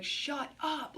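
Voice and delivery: high sharp shut up; snapping shut up. Retold or imitated. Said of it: "she was like".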